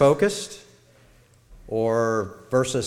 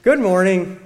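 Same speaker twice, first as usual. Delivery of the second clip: higher and louder, smiling greeting; happy; 6 semitones higher, 7 dB louder than usual. Shown as a demonstration. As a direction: say it with a big happy smile. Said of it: "with a happy smile".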